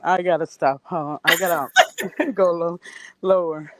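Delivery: usual speaking voice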